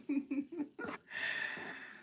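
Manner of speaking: high, drawn-out giggle; amused laugh. Real, not acted. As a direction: giggle helplessly at the question. Amused by the question asked.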